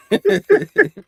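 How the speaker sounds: high burst of laughter; high real laugh. Real, not acted. Fan laughing at his own jingle, high and loud.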